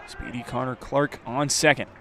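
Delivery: ordinary talk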